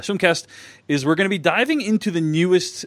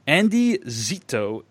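ordinary talk

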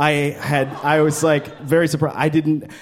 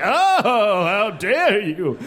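slow, high "how dare you"; mock offended; 5 semitones higher, much slower than usual. Audience member offended by the joke, slow mock outrage. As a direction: clutch pearls in outrage. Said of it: "You're like".

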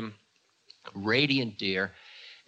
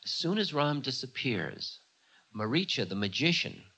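normal talking voice

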